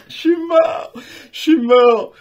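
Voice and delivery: a real giddy laughing line. Real, not acted. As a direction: laugh it out, overwhelmed. Laughing after the double pull.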